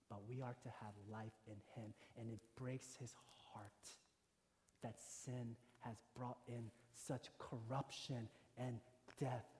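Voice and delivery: very soft, low, with heavy pauses; hushed sorrow. Real, not acted. Preacher explains why Jesus wept, hushed and sorrowful. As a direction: bring it down almost to a murmur.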